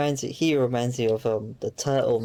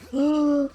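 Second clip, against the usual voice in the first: sharp high gasp of fright; startled gasp; 14 semitones higher than usual. Real, not acted. Gamer nearly falling in the level, sharp startled gasp. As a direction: catch your breath in sudden alarm. Near death in game.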